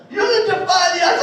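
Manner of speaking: loud, high, emphatic pep-talk line; rousing retold encouragement; imitated mom's urging. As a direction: mimic a mom firing up her kid. Mom voiced urging her son.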